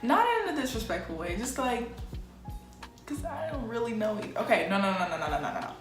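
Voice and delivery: ordinary talk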